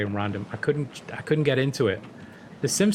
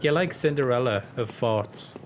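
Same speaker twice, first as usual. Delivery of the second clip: usual speaking voice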